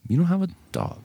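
high-pitched incredulous objection; high mock-puzzled voice; mock disbelief. Acting out listener doubting excuse.

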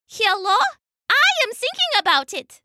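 high-pitched little mouse voice. A narrator's reading. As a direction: pipe up as a tiny mouse. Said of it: "Lucia the little mouse".

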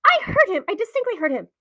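high, loud, agitated protest; alarmed, high-pitched aunt; frightened insistence. Acted. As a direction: insist in a panic, high and forceful. Frightened aunt insists, after whispering.

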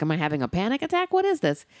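retold alarm, voice shooting up at the end; mock panicked self-questioning. Viewer recalling panic during the film, pitch leaping up.

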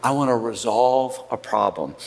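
a hint of a soft conciliatory plea; gentle heart. Shown as a demonstration. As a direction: speak to calm the fight. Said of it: "reveals this heart that says".

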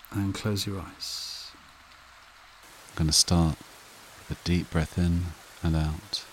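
soothing breathing-exercise delivery, spaced out and unrushed; guided meditation. Acted. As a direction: guide them gently into calm, phrase by unhurried phrase.

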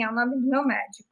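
normal talking voice

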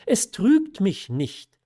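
high, loud, slow protest; emphatic insistence. A narrator's reading. Daughter sure of her inner feeling, emphatic insistence. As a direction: insist on every word.

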